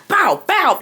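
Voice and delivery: mouth-made gunshot noises; rapid mock gunfire from the mouth; imitated shots, bow bow; gunshot sounds.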